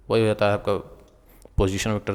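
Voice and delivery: ordinary delivery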